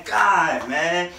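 usual speaking voice

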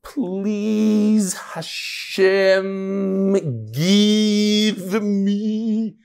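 drawn-out pleading whine; mock whiny plea to Hashem; whiny begging. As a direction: beg like a needy child. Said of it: "maybe Hashem doesn't like when you say".

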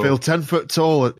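put-on northern English accent, beaming. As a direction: say it like a chuffed Northerner. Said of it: "doing it in a northern accent".

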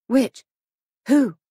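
lightly voiced anxious woman; anxious questioning. A narrator's reading. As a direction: ask urgently in short bursts. Anxious questions to the exultant policeman.

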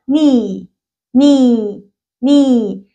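"ني" said as nee with no k; silent k demo. Shown as a demonstration. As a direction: open "ني" straight on the n. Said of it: "وكلمة زي كلمة مثلًا الركبة". ("ني" من غير ال K)

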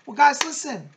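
loud, high call to the viewers. Real, not acted. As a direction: grab everyone's attention. Calling out to viewers before signing off.

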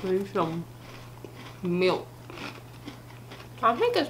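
speaking between bites; chewing. Real, not acted. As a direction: mouth partly full. Seen 1: Woman bit cookie just before; jaw and lips chew while speaking.

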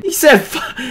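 loud, high, incredulous ranting; can't believe what he just heard; outraged disbelief. Real, not acted. Streamer ranting to chat about the insult in loud, high disbelief.

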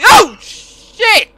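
startled high yelp; scared yelp. Real, not acted. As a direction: jump at the scare. Jump scare in horror game.

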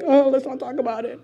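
mock reluctant churchgoer voice; lightly put-on evasive voice; timid Christians. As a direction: imitate someone shying away from a subject. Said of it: "all the Christians are like".

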